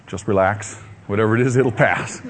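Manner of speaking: a low, lightly laughing "just relax"; self-reassurance. Retold or imitated. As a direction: talk yourself down gently. Calming himself during strange sensation.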